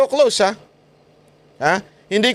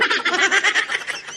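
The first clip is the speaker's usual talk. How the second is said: high cackle; 14 semitones higher, 3 dB quieter than usual. Real, not acted. Laughs about hearing his voice.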